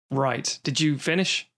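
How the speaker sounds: ordinary talk, nothing marked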